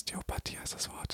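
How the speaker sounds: quick hushed whisper; whispered aside. Real, not acted. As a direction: slip the word in under your breath.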